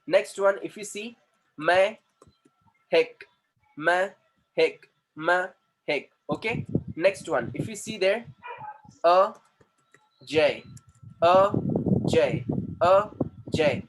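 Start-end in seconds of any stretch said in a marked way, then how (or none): 1.6-6.1 "Mehek" as Me, pause, hek; split into syllables. Shown as a demonstration. Teacher showing the next name, "Mehek" split Me - hek. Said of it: "there are two syllables". 9.0-13.8 "Ajay" as A, long pause, jay; two detached beats on "Ajay"; split into syllables. Shown as a demonstration. Teacher demonstrating syllables to students, "Ajay" split A - jay. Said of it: "So this is what exactly".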